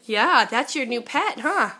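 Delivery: ordinary talk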